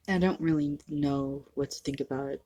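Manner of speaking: normal talking voice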